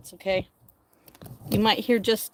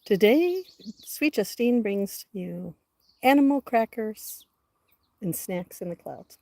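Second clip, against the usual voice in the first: announcer voice; 3 dB louder than usual. Acted. Announcing her show title.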